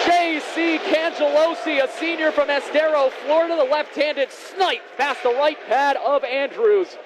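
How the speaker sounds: high, drawn-out goal call; long goal yell. Real, not acted. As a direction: stretch the goal call out, excited. Goal call, live play-by-play.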